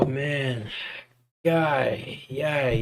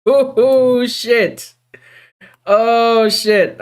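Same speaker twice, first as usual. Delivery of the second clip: dismayed yell; 8 semitones higher, 3 dB louder, much slower than usual. Real, not acted. Realizing the crash.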